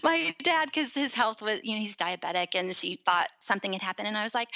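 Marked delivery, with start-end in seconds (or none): none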